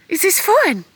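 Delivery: high sing-song doggy talk; high pet-talk voice. Real, not acted. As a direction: coo at the dog happily. Asking the dog.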